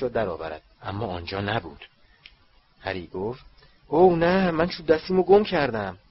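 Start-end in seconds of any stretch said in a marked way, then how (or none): 3.9-6.0 high, louder cry of alarm; dismayed. Acted. Harry finds his wand is missing, dismayed. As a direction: exclaim it in sudden dismay.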